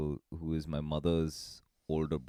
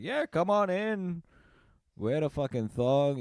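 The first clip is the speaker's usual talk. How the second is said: laid-back host; 11 semitones higher than usual. Retold or imitated. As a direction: wave everyone in like nothing matters. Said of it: "He's like".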